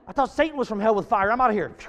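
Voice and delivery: loud, quick comic alarm; mock panic. Preacher acting himself fleeing the burning bush, comic loud alarm. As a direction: blurt it out as if about to bolt.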